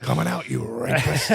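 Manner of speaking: slow, hammed-up accusing voice; wrestling boss impression. Podcaster making co-host laugh, slow mock villain voice. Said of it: "quite a decent impression you do there".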